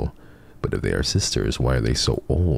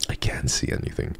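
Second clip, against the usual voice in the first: breathy whisper in the dark; 5 dB quieter than usual. Real, not acted. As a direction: whisper it as if afraid to be heard. Repeated in a whisper.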